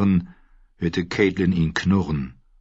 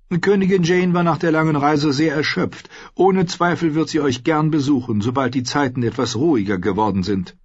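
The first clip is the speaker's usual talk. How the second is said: higher polite, smoothing voice; lady's voice; 8 semitones higher than usual. A narrator's reading. Caitlin apologizing for the absent queen. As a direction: excuse the queen with a courteous calm.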